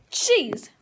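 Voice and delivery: ordinary talk, nothing marked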